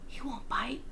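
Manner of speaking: unvoiced, dismissive whisper; burglar whisper. A narrator's reading. Second burglar brushing off the warning, whispering. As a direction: whisper it with a shrug. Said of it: "said the other".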